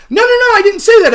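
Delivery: high, pushed, squeaky mock whine of no no no; mocking denial. Rob mocking his opponent's denial in a high, loud whine. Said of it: "And I'm like".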